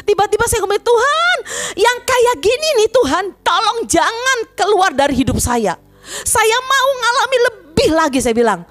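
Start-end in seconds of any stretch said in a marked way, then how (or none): 0.8-8.2 high re-enacted plea to God; pleading prayer. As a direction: cry out imploringly, high-pitched. Acts out own desperate prayer.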